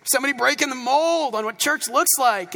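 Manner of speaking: loud, high burst of delight; excited yes. Retold or imitated. Pastor retelling meeting a tattooed pastor friend, excited cheer. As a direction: cheer it like you found a kindred spirit. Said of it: "And I was like".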